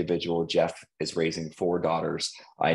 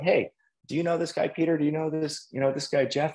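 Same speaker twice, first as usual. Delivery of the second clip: higher, quick, breezy favor request; casual ask to a friend; 9 semitones higher than usual. Retold or imitated. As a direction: toss off the question lightly to a buddy. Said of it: "So I was like".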